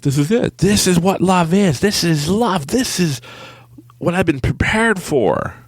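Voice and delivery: high-pitched, overblown revelation; hammed-up elation. Realizing this is love, hammed-up elation. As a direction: proclaim it high, drawing out the words. Acts out his overjoyed realization.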